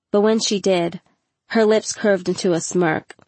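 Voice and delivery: plain reading voice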